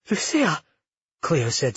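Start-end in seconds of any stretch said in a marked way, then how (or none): 0.1-0.6 higher, falling stunned name. A narrator's reading. Cleo sees Lucia waiting for them, stunned. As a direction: say the name in mild shock.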